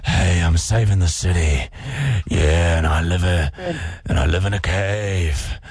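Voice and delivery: low, slow gravelly superhero; Batman voice. Acted. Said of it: "I reckon it's Batman".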